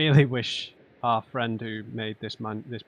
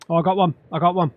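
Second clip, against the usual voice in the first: high, loud, thrilled discovery; excited; 7 semitones higher, 9 dB louder, much faster than usual. Real, not acted. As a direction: burst out with real excitement. Finds the symbol at last.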